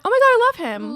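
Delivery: high, loud squeal of adoration; loud high-pitched swooning; gushing. Retold or imitated. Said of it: "you went".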